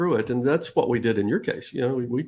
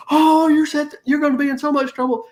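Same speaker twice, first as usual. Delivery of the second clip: high, loud mock gasp of scandal; quick, high-pitched playground alarm; taunting kids; 14 semitones higher, 5 dB louder than usual. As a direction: imitate children crowing that someone's in trouble.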